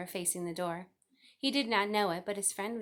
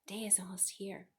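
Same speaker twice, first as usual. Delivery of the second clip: soft hushed whisper; 9 dB quieter than usual. A narrator's reading. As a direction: breathe it so nobody wakes. Wilbur thinks in the dark barn.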